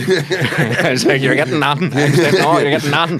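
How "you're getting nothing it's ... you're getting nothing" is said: loud, high, laughing defiance at the police; defiant Belfast refusal. Retold or imitated. To Ukrainian police demanding bribe, laughing defiance. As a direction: refuse flat out, grinning. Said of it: "the wee North Belfast Fenian came up in me".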